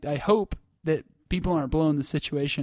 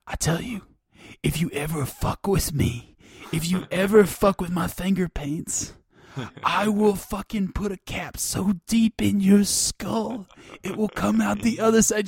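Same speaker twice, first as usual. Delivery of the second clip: higher, hammed-up tough-girl threat; a little girl playing gangster, higher; mock gangster threat; 5 semitones higher, 3 dB louder than usual. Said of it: "the most ridiculous threat you can imagine".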